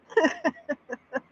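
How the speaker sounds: high, helpless giggle; real high-pitched laughter; giggling fit. Real, not acted. Cracks up recalling what he said.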